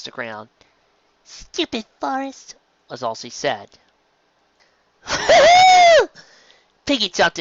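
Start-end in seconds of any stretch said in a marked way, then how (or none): 1.2-2.5 much higher, slow sulky filly voice; filly grumbling. Acted. Sleepy grumbles. 5.1-6.1 much higher, loud burst of giddy laughter; wild laugh. Acted. Pinkie laughing wildly.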